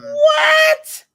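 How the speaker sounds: high, loud shriek of disbelief; startled shouted "what"; shocked. Real, not acted. Reacts to news of virus emergency.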